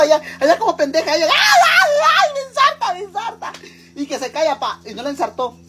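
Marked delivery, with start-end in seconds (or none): 1.3-3.5 very high shrieking ay, then squealed pleas; mock screaming woman. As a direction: scream it high like a panicked woman, fading at the end. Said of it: "Imelda Márquez gritando". (grito agudísimo de mujer asustada, fingido)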